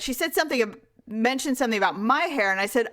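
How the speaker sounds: normal talking voice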